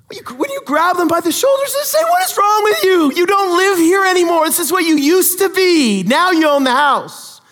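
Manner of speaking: high, loud, pleading shake-them-awake outburst; exasperated loud rebuke. Retold or imitated. Benefactor scolding the ungrateful family, loud and exasperated. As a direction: yell it like you're shaking someone by the shoulders.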